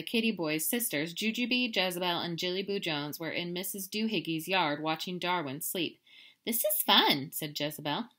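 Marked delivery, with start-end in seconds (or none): 6.4-7.3 sister. Acted. Sister character line.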